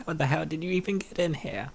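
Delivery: ordinary delivery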